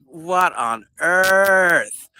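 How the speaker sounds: drawn-out stunned shout; shocked shout. Real, not acted. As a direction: yell it out slow in disbelief. Surprised at many messages.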